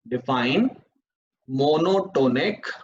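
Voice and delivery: very slow, word by word; spelled out for note-taking; slow dictation. Shown as a demonstration. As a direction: dictate slowly so students can write. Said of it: "dictating definition slowly".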